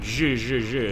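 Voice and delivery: "zh" as a drawn-out buzzing sound; repeated "zh" like the s in measure; demonstrating a sound. Shown as a demonstration. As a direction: sound out "zh" separately, several times. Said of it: "It's that zh sound".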